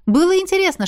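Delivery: ordinary delivery, nothing marked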